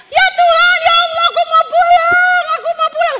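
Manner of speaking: high, loud panicked scream to go home; terrified screaming. Retold or imitated. Frightened souls in the afterlife screaming to go home. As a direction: shriek it like you're lost and terrified. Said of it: "takut semua orang berteriak".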